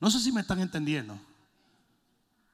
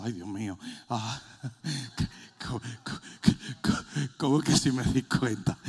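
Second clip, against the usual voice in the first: mock desperation; 7 semitones lower than usual. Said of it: "Y tú estás desesperado diciendo".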